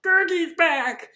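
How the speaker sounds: very high, drawn-out creature squeal; Gurgi. Retold or imitated. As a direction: announce yourself like an excited cartoon critter. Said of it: "a Gurgi thing appears again".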